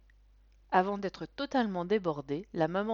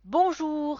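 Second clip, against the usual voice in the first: put-on high, loud kids' chorus; children in chorus; 10 semitones higher, 10 dB louder than usual. A narrator's reading. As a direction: answer like a crowd of eager children. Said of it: "répondent-ils tous en chœur". (chœur d'enfants aigu et fort)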